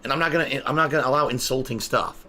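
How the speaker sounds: usual speaking voice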